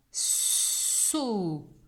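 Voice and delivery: "So" with a very long hissed s; sounding out. Shown as a demonstration. Teacher dictates second syllable to pupil, "So" stretched. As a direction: stretch "So" as sssso. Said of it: "on le répète deux fois".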